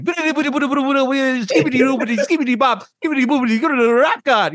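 high-pitched, rapid-fire gibberish rap impression. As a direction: spit nonsense syllables like a speed rapper. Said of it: "that was a great [name] impersonation".

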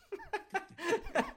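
high-pitched burst of laughter. Real, not acted. Laughs after answering question.

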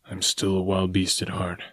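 softly, as though to himself; low voice. A narrator's reading. Tarzan admits his wild nature to himself, low and quiet. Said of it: "he said in a low voice, as though to himself".

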